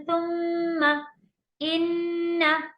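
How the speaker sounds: सुम्मा and इन्ना slow, doubled m and n hummed long through the nose; slow Quranic chant; ghunna on shadda. Shown as a demonstration. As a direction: hold the nasal hum in सुम्मा, इन्ना. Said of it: "गुन्ना करके पढ़ेंगे".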